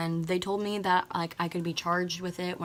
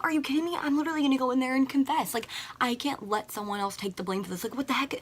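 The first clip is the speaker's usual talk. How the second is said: higher-pitched, rushed panicky protest; retold freaking out; 6 semitones higher than usual. To friend before confessing to the alarm, retold panicked protest. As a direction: re-enact a mild freak-out. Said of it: "I was, like, freaking out".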